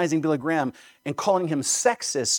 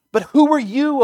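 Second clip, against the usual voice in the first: loud, much higher rebuke of the challenger; thundering Paul; 10 semitones higher, 8 dB louder than usual. Retold or imitated. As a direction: hurl the apostle's rebuke at the challenger. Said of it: "He says".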